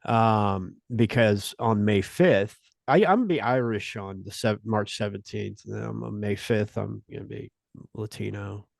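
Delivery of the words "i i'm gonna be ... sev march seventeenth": usual speaking voice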